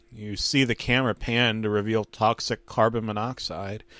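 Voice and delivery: usual speaking voice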